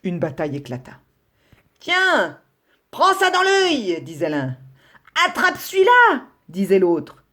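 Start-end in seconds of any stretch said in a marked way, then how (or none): 1.8-4.0 much higher, punchy taunt while throwing a blow; fight taunt. Acted. Rabbits brawling, taunting blows. 5.3-6.3 much higher, punchy taunt while throwing a blow; fight taunt. Acted. Rabbits brawling.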